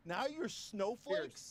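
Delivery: very slow, every word drawn out; slow taunt. Acted. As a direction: drag it out to mock them. Mocking opponents as snowflakes.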